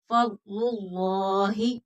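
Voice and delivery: फज़लुल्लाहि slow, heavy dark lam in Allah; फज़लुल्लाहि with a full, thick lam; tajweed demo. Shown as a demonstration. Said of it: "लाम पुर मोटा करके".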